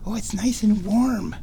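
high cooing voice on warm. Real, not acted. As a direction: coo it to the kitty. Baby talk to the cat.

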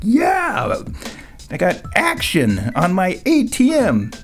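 high, thrilled cheer of triumph; excited whoop. Retold or imitated. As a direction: whoop it out like you won big. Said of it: "I'm like".

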